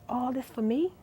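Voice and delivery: soft, quiet wonder; half to yourself, barely voiced; awed murmur; hushed disbelief. Overwhelmed, retelling prayer to God.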